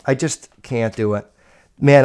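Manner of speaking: usual speaking voice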